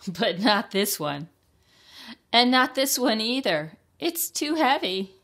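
high, loud hen character voice. Acted. Hen rejects flower pots.

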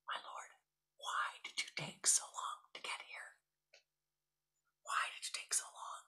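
hushed, tearful whisper. A narrator's reading. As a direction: whisper it close, near tears. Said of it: "And she starts to whisper".

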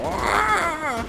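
high yelp imitating a dying Yoda; bad Yoda death cry. Gamer imitating Lego Yoda dying, mock high yelp. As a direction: squeak out a game death sound. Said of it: "my impression of the Yoda sound effect".

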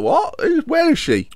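lightly voiced, high, puzzled passerby; a hint of a startled stranger outside; confused passerby. Imagined passerby hearing the shout, lightly voiced puzzlement. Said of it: "And he's thought".

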